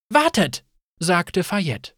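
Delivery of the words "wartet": high, louder call to halt; sudden raised voice; urgent call. A narrator's reading. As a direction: stop them before they leave. Urgent call to halt departing group.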